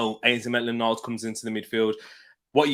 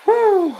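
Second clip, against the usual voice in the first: high whoosh of relief; 7 dB louder than usual. Real, not acted. Reacting to game kick-off screen.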